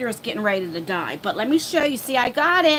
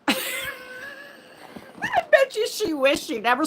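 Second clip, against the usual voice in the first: high, slow laughing words; 12 semitones higher, much slower than usual. Real, not acted. Streamer laughing about pestering her helper B. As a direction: laugh through it at your own cluelessness.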